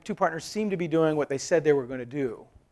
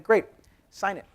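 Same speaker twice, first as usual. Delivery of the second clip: brisk demand; 7 semitones higher, 7 dB louder than usual. Retold or imitated. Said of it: "I'm like".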